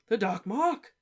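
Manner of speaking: breathless, high and loud exclamation; panting alarm at the skull; panted. Acted. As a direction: gasp it out, winded. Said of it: "he panted".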